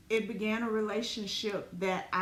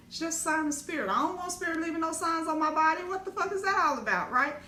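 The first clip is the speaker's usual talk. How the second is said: ordinary delivery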